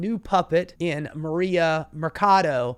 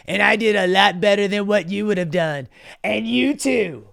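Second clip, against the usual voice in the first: mock Trump; 3 semitones higher, 5 dB louder than usual. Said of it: "he goes".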